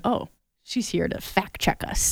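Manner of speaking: hushed aside, almost voiceless; whispering. Retold or imitated. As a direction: whisper it so she won't hear. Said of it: "and I'm like".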